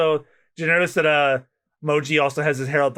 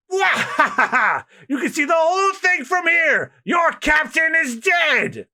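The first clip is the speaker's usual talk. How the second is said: much higher, slow laughing shout; gloating laugh; 14 semitones higher, 3 dB louder, much slower than usual. Acted. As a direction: crow over the crowd. Buggy gloats mid-execution.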